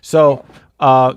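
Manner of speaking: ordinary delivery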